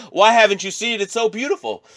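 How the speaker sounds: high-pitched mock astonishment; exaggerated high questioning, softening at the end; acted viewer's question. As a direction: put on a high, gushing voice. Acts out someone questioning his hidden art.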